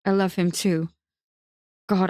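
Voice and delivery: usual speaking voice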